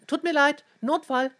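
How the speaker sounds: high, loud emergency call; hurried call. A narrator's reading. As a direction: toss a rushed apology on the run. Calls out while grabbing phone, running.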